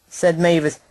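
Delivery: usual speaking voice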